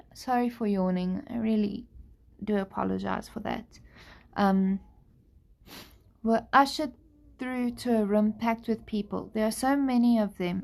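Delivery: usual speaking voice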